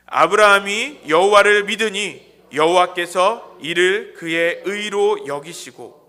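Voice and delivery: loud, slow reading aloud together with the congregation; unison scripture reading. Shown as a demonstration. Pastor leading the church in reading the verse aloud, loud slow unison reading. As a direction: read it out slowly and loudly, all together, stretching the final line. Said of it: "같이 읽겠습니다".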